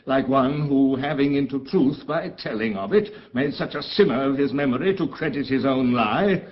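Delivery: mounting anger against a false brother; rising indignation. Acted. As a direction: let the outrage climb line by line. Prospero's anger at brother builds.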